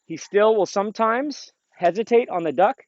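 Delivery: ordinary talk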